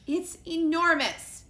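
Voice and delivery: high, loud, slow grumpy squirrel; grumpy snap. Acted. Grumpy squirrel snapping at the detectives. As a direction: snap it, fed up. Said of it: "snapped the squirrel".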